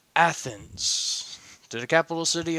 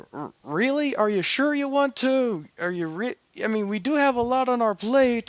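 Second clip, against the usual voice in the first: nervous table of companions; 9 semitones higher than usual. Acted. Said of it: "everyone else at the table is going".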